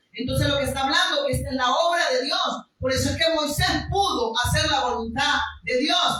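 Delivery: loud, driving sermon delivery; fervent preaching. Acted. Pastor exhorts congregation, fervent preaching. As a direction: preach with conviction to the room.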